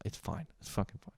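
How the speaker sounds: soft, unvoiced forced calm; choking down shrooms and insisting it's fine, hushed; strained whispered reassurance. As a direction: whisper it through the aftertaste. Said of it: "you're like".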